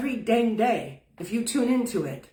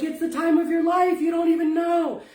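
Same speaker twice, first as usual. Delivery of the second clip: urging shout; 7 semitones higher than usual. Pretend. Urging leaf, shout heard.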